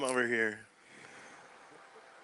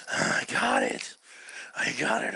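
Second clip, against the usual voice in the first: whispered; 12 semitones lower, 3 dB quieter than usual. Retold or imitated. Quoted father, breathy, whispered.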